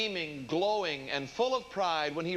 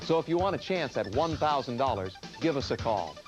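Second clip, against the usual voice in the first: upbeat TV promo voice; announcer read; 5 semitones lower than usual. Acted. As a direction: read it like a game show promo. Game show promo read.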